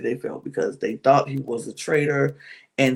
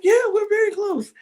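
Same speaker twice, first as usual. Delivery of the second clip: high-pitched put-on voice; imitated tearful insistence. Imitating Linda defending the friendship, mock insistence.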